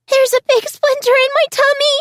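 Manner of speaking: put-on very high little child's voice; a frightened small child complaining; high-pitched child. A hurt child telling Jessa about the splinter, high and scared. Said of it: "a high-pitched voice repeated".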